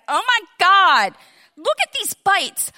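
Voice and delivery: high, loud alarmed cry; daughter's alarm. Retold or imitated. Daughter showing her mom the bites, loud alarmed. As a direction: shriek it like a horrified girl. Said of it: "she was like".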